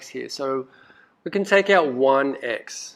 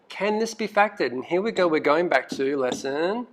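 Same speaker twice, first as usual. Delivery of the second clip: ordinary delivery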